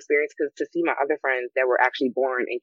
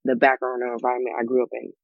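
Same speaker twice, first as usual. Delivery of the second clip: ordinary talk, nothing marked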